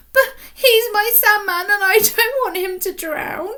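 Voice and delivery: put-on high, crying child's voice; little girl starting to cry; tearful. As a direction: let the words crack with tears. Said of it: "she began to cry".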